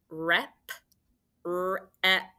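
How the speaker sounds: "Rep" said whole, then "rep" as three separate sounds; sounded out. Shown as a demonstration. Teacher tapping out a syllable for spellers, "rep" sounded out. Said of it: "this is a multisyllabic word".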